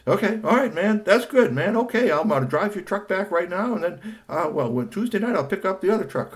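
a hint of the buddy on the phone. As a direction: talk like the easygoing buddy.

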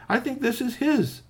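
high-pitched character voice; lifted, animated delivery; eager offering. Acted. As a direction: present the find in a high, eager voice. Uncle Key produces the helmet.

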